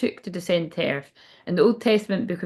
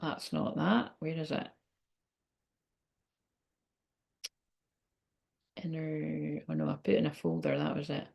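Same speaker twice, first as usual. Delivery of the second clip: soft, low, absent-minded search talk; much quieter, half to yourself; talking to oneself; 3 semitones lower, 11 dB quieter than usual. Real, not acted. Reader hunting for a folder, talking low to herself.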